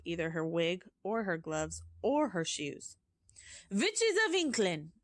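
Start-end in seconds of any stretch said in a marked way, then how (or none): 3.7-4.9 higher, louder yell. Acted. Grand High Witch calls to her witches again, high loud yell. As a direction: call out again, sharper and higher. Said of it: "she yelled".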